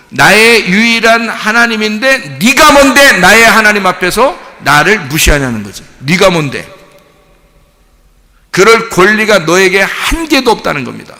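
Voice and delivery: loud, forceful sermon delivery, often pitched high; impassioned preaching. Real, not acted. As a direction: drive each point home to the congregation at full force. (힘주어 외치는 열띤 설교)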